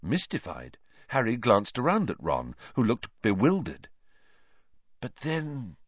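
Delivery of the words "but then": soft, half-voiced mutter to himself. A narrator's reading. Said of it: "Lupin muttered".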